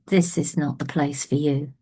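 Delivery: put-on old man quietly turning him away, understated sadness; mild sadness. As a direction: let it fall gently and sadly. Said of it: "looks at him really sadly".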